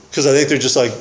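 ordinary talk, nothing marked

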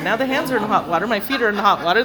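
usual speaking voice

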